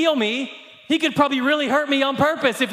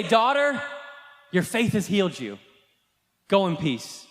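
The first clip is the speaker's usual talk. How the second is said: soft and much lower, gentle; tender; 5 semitones lower, 3 dB quieter than usual. Retold or imitated. Jesus speaks gently to the healed woman, tender and soft. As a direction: reassure her softly like a father.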